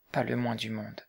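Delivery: slow, quiet and deliberate; slowly. A narrator's reading. Sylvia refusing the old Quaker, slow and quiet. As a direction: let each word fall slowly and softly.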